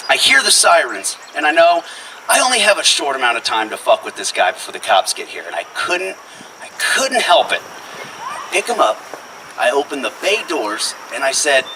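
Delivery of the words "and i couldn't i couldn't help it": normal talking voice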